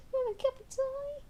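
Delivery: high, amazed question; incredulous. Real, not acted.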